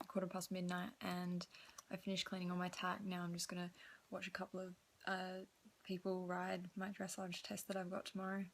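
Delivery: soft, hushed midnight vlog voice; quiet late-night talk. Real, not acted. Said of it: "I have my retainer in".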